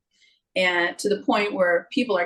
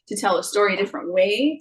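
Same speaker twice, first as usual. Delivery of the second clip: usual speaking voice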